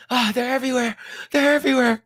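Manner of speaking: high panicked cartoon kid voice; Cartman nightmare. Streamer retelling Cartman's nightmare, high panicked voice. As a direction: cry out in high-pitched terror. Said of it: "He's like".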